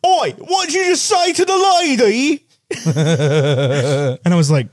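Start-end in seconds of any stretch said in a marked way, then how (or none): 0.0-2.5 high, hammed-up Cockney accent; Matt Smith. Film character confronting someone over a lady, mock Cockney shout. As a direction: challenge them in exaggerated London slang tones. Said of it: "he turns around in Last Night in Soho and goes".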